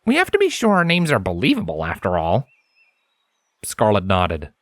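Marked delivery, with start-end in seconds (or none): 0.0-2.5 higher, earnest scholarly stallion, serious; Lens's voice. A narrator's reading. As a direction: speak as the studious unicorn, intent on his work. Narrator voices Lens.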